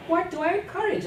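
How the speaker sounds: mother firing back at her husband; indignant demand. A narrator's reading. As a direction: throw the accusation back at him. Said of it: "Amma demanded".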